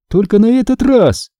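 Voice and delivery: high, mildly affronted retort; indignant. A narrator's reading. Sister retorting to brother's grudging praise, indignant. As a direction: object with a touch of outrage. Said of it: "возмутилась я".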